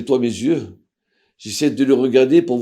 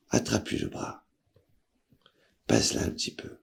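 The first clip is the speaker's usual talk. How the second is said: whisper; 9 dB quieter than usual. Retold or imitated. Rabbi instructing quietly at bedside.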